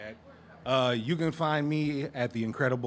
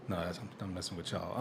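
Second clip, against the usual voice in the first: normal talking voice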